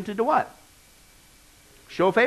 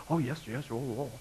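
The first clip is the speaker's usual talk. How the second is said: mock fawning; 5 semitones lower, 11 dB quieter than usual. Mocking church fawning over donor.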